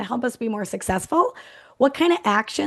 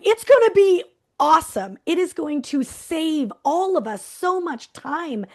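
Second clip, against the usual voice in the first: higher, glowing mock enthusiasm; all-out excited; 6 semitones higher than usual. Coach imitating a thrilled manager, higher and gushing. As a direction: gush about it like he did. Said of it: "he just went all out".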